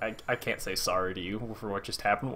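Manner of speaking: very soft, low, halting admission; imitated Logan, quietly resigned; subdued acted regret. Voicing Logan's look at the farmer.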